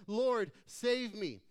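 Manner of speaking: loud, drawn-out cry for help. A narrator's reading. Peter beginning to sink calls to Jesus, crying out. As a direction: call out slowly and loudly, as if sinking. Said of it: "cried out".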